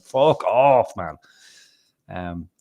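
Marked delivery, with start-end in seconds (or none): 0.0-1.1 fed-up curse. Retold or imitated. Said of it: "It's like".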